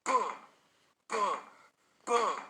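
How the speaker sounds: three loud, high booms, evenly spaced; booming knock sound effect by voice; imitated door banging. A narrator's reading. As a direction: punch each boom like a fist on a door.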